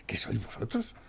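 a scornful whisper; whispered contempt. Real, not acted. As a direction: breathe the question out in disgust. Ranting at government, drops to whisper.

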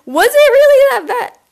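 high, loud incredulous question; shrill mock-shock repeat; squeaky high disbelief. Real, not acted. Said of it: "Oh my God".

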